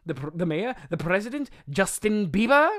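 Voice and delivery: hammed-up rising guesses; Cletus Cassidy, mad guessing. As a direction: build to a loud, stretched name. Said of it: "says something very strange".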